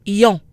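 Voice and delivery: "Yon" glide into a nasal o; French nasal "Yon"; French ion. Shown as a demonstration. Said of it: "I O N is yon".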